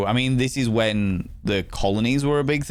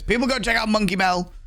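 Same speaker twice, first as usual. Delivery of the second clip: excited shout-out; 12 semitones higher than usual. Real, not acted. Shouting out a viewer.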